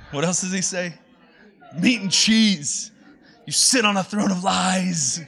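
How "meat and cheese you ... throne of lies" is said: imitating Buddy. Pastor quoting Buddy calling out the fake Santa. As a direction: drag out the accusation like Buddy. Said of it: "and he's like".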